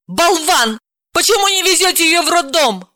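high, furious scolding; woman flaring up, pitch way up; losing her temper. Acted. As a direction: scold him in exasperation. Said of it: "психанула Далила".

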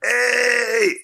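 high-pitched re-enacted holler; retold shout. Drunk guest hollering across the silent tent, high mock shout. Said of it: "ich seh das und schrei".

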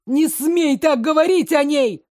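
high, loud outraged cry; furious. Acted. Drugged adjutant defending the empress, outraged high cry. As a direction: scream it in blind rage.

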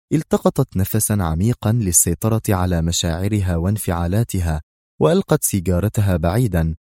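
ordinary delivery, nothing marked

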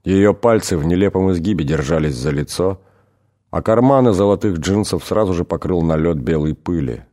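ordinary delivery, nothing marked

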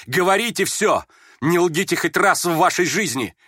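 high, agitated order; trembling command. A narrator's reading. Trembling youth ordering honesty from his listener, imperiously. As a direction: demand it with feverish urgency. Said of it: "Дрожал и приказывал Ипполит".

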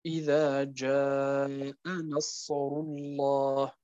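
جاء recited slowly with its madd held four beats; slow Quranic recitation stretching جاء; lengthened madd. Shown as a demonstration. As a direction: draw out the long vowel in جاء. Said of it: "واضح أخواني الكرام؟".

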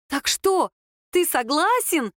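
high, eager question with a slowed finish; excited. A narrator's reading. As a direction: ask as if nearly leaping from your seat. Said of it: "чуть не подскочила на стуле Лера".

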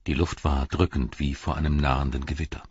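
plain reading voice

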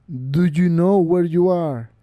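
very slow menacing stranger; voice from beyond the grave. A narrator's reading. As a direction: drag it out, menacing. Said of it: "El viejo repitió".

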